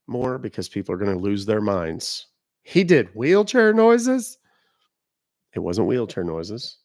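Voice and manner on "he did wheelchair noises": high, loud incredulous question; slow rising squeal of disbelief; mock disbelief. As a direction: voice a stunned listener, pitched way up. Voices viewers' disbelief at the story.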